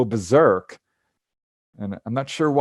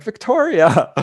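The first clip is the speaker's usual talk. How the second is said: excited greeting; 10 semitones higher, 5 dB louder, slower than usual. Retold or imitated. Meeting sister on astral plane.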